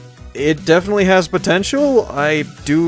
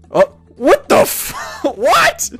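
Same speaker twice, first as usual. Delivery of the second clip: shocked disbelief; much slower than usual. Real, not acted. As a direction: burst out in high-pitched disbelief, breaking into laughter. Shocked by game event.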